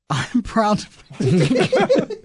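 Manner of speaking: can't keep it straight; sincere attempt, cracking up. Real, not acted. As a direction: start earnestly and break into laughter. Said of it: "I'll try it sincerely".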